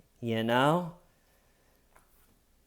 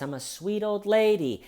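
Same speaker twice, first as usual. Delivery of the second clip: high, sugary mock old-lady voice; a higher, sing-song sweet-old-lady voice; 9 semitones higher than usual. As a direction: play the innocent sweet old lady, lightly. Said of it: "Nancy's up there with a sweet voice".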